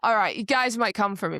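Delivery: ordinary delivery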